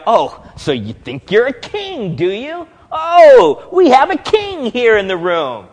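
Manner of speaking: hammed-up scornful taunt; sarcastic. Shown as a demonstration. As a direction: ridicule the prisoner's claim to be king. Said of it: "Pilate wasn't saying sarcastically".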